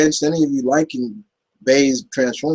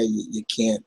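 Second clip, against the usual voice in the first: ordinary delivery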